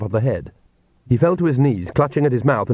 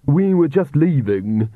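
slow, haughty and cool; lofty young woman brushing him off; 4 semitones higher, slower than usual. A narrator's reading. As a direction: look down your nose at him. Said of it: "said Magrat haughtily".

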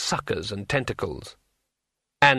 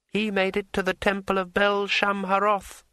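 smooth dryad voice; 8 semitones higher than usual. A narrator's reading. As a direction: glide through it smoothly. Dryad speaks.

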